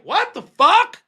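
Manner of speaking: high, slow, loud outrage; shocked yell. Retold or imitated. Teen yelling at a grown man punching a kid, high and loud. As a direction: yell it in disbelief.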